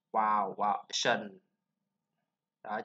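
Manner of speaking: normal talking voice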